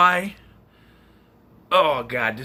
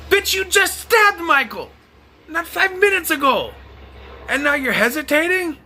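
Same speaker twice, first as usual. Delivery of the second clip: much higher, exasperated shout at the screen; outraged yelling; 11 semitones higher than usual. Real, not acted. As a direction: yell at the dumb character in disbelief.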